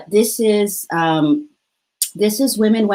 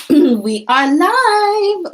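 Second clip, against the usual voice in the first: much higher, drawn-out slow announcement; excited announcement; 11 semitones higher, 4 dB louder, much slower than usual. Real, not acted. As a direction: announce going live with a big lift. Restarting livestream as host.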